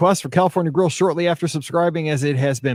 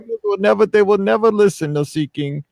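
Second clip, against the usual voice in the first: put-on Caribbean accent; 5 semitones higher than usual. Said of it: "That's a very bad Caribbean accent".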